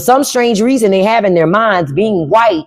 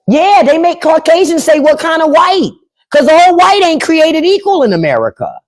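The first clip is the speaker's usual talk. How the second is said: heated, raised voice; 8 semitones higher than usual. Real, not acted. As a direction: raise it up and drive the point.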